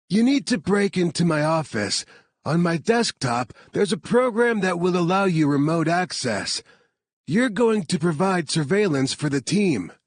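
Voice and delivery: lightly voiced engineer giving instructions; Harvard voice. A narrator's reading. Harvard instructing Sammy over the phone, lightly voiced.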